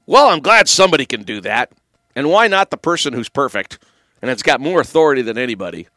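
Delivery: usual speaking voice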